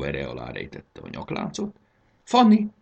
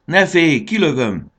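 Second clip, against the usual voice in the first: loud, raised-pitch call; shouted reassurance; 9 semitones higher, 8 dB louder than usual. A narrator's reading. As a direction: shout it across to steady her. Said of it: "kiáltotta Marci". (hangosan, bátorítóan kiáltva)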